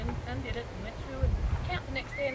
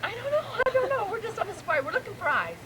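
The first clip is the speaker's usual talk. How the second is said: bewildered; 8 semitones higher than usual. Retold or imitated. Puzzled friend retold on safari.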